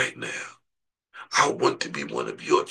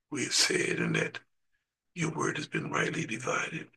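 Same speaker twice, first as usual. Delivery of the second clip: soft, hushed prayer to the Father; closing prayer; 6 dB quieter than usual. Acted. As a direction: pray quietly over the congregation.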